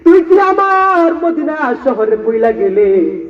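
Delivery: high, stretched, chanted calling of a name; preacher voicing a dream summons. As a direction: call him home tenderly, long vowels. Prophet calls Bilal in dream.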